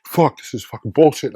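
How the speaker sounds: loud, high mock-angry outburst; rallying rant. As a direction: imitate him rallying the troops with cursing. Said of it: "he's rallying the troops".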